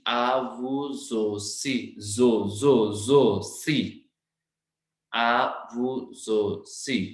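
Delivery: आवुजोसी slowly, with the जो in the middle stressed; slow model of आवुजोसी with a clear ज sound; slow syllable drill. Shown as a demonstration. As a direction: break आवुजोसी into sounds, then join them. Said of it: "एस के साथ यहाँ पे ज sound बनेगी".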